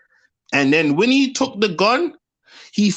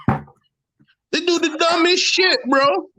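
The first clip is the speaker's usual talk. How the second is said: drawn-out exasperation; 9 semitones higher, slower than usual. Real, not acted. To his co-host about Brampton, drawn-out high exasperation. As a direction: stretch out "dumbest shit", pitched way up and slow.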